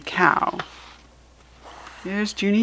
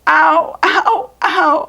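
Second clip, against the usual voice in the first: high, loud hollered "my toe"; a kid wailing in pain; hollering; 9 semitones higher, 6 dB louder, much slower than usual. Acted. Junie B hollering in the backyard over her hurt toe. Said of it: "I yelled again".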